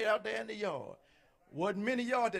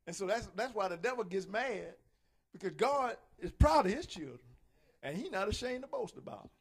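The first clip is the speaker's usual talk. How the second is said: ordinary talk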